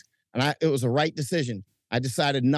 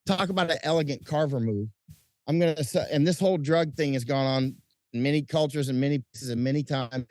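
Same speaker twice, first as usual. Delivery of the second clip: ordinary talk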